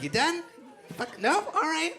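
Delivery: high-pitched, questioning mock patience; patient checking in. Talking to someone punching it out, high mock-patient voice. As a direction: check in lightly, pitched well up.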